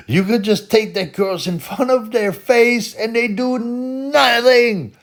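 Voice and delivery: high, loud mock voice of the Algerian guy; imitating Algerian guy. Quoting the Algerian guy's boast about Swedish clubs in a high, loud voice. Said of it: "he goes, he goes".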